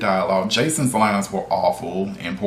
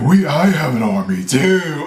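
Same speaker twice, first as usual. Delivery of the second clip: louder mock generic villain voice; 5 semitones higher, 5 dB louder than usual. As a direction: ham up a cheesy bad-guy line. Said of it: "generic villain voice terrible".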